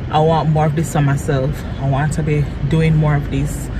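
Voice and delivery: usual speaking voice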